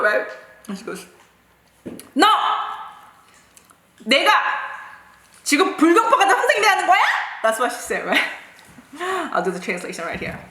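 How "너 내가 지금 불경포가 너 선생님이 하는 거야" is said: high, rapid-fire mock tirade; yelling teacher. Teacher berating a rebellious pupil, high imitated yelling. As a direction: imitate a furious teacher scolding.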